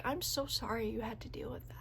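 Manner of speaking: hushed, unvoiced apology; whispered sympathy. Customers whispering sympathy to the shaken cashier. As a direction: whisper it kindly.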